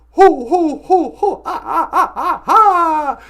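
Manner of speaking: ho-ho turning into villain cackle; loud hammed-up Santa laugh; Santa turned vampire. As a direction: jolly laugh building to mock vampire laugh. Bitten Santa turns vampire, laughing.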